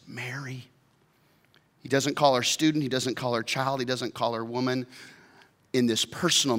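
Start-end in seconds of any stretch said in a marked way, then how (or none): 0.1-0.7 soft, quiet calling; tender name. Acted. Jesus gently says Mary's name, very soft.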